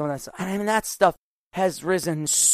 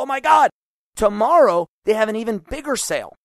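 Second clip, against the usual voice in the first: excited; 5 semitones higher, 5 dB louder than usual. Retold or imitated. Investor cheering a market drop.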